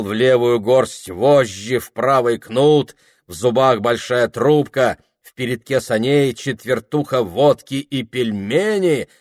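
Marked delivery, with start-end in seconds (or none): none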